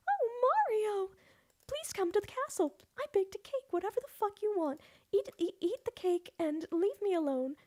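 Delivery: high, sweet princess voice, very high; sing-song Princess Peach pleading with Mario. Retold or imitated. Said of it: "I can do a decent, like, normal Peach".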